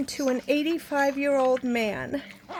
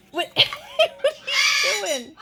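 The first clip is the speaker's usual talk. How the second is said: slow, high, laughing question; 7 semitones higher, much slower than usual. Real, not acted. Owner laughing at her parrot's mischief, high and slow. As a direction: laugh through the words, drawn out. Bird misbehaving, she laughs.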